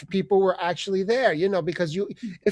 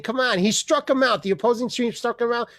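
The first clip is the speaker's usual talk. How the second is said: ordinary delivery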